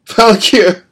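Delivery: grief-stricken scream; anguished cry. A narrator's reading. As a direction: scream for the dying dog. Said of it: "the boy cried".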